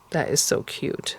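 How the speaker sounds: slow breathy whisper, fond; slow whispered coo. Real, not acted. Whispering to herself that the watermelon is cute. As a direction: linger on it in a hush. Cooing over the fitted piece.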